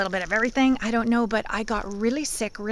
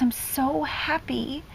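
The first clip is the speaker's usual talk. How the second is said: put-on higher, softer, drawn-out child's voice; retold tearful confession; 5 semitones higher, 5 dB quieter, slower than usual. Crying son tells mom he's happy, retold tearful confession. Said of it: "huge meltdown and started crying".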